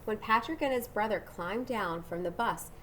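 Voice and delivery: usual speaking voice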